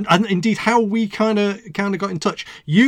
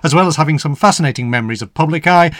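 louder, projected presenter's read; radio show introduction; 7 dB louder than usual. Acted. Host welcomes listeners and introduces the week's guest, radio announcer delivery.

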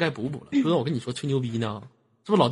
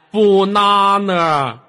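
banana stretched very slowly and loud; teacher modeling banana slowly; drawn-out banana; 6 semitones higher, 9 dB louder, much slower than usual. Shown as a demonstration. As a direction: hold each syllable of banana long. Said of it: "要拉长音".

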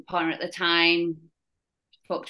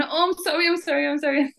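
higher, lightly mocking apology; flustered doctor; 8 semitones higher, faster than usual. Junior doctor apologising to the patient, mock flustered. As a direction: play the nervous junior doctor backing off. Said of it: "He's going".

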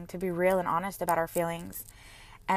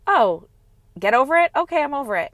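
mock dismissive quote; 5 semitones higher, 5 dB louder than usual. Mocking dismissive advice to self.

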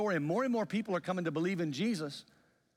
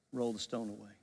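low, quieter firm command; firm insistence; 5 semitones lower, 4 dB quieter than usual. Retold or imitated. Jesus answering the sister's objection, low and firm. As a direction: overrule the objection in a lowered voice.

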